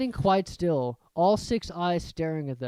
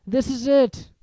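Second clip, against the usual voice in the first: moaning; 8 semitones higher, 6 dB louder than usual. A narrator's reading. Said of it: "Ron moaned".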